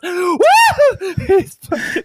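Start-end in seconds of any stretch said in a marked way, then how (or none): 0.0-1.2 high imitated "woo" of glee; pumped-up whoop. Imitating the trainspotter honked at by the train, pumped whoop. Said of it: "he gets freaking pumped".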